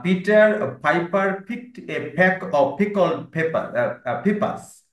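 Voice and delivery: each word of the tongue twister said very slowly; slow model reading. Shown as a demonstration. Teacher slowly models the tongue twister for the class. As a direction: model it slowly so they can copy. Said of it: "pronunciation গুলো একটু বলছি".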